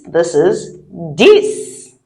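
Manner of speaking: "dix" slowed and rhymed with six; drawn-out "dix" said like English six with a d; slow clear dix. Shown as a demonstration. Teacher slowly models "dix" for beginners. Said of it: "Just like six".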